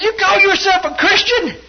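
high, loud imitation of an accusing brother; outraged accusation. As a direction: act out scandalized disbelief, full voice. Retold brother's angry accusation.

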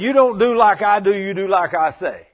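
loud, high rebuke, slowing at the end; angry father. Retold or imitated. Father angrily tells son to obey, loud. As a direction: lay down the law to the boy.

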